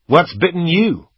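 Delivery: high, loud jab of a question; loud challenge. A narrator's reading. Gunman to girl in the car, loud challenge.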